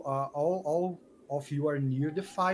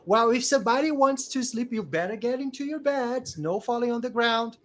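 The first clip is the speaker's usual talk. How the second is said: higher, louder telling-off; scolding camp adult; 8 semitones higher, 6 dB louder than usual. Acted. Camp adult to boys lying on the ground, scolding. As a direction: order the kids about sternly.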